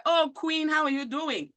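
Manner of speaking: imitated high-pitched, welcoming hello; welcoming greeting. Recalling her first words on the phone, welcoming greeting. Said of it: "your first words were".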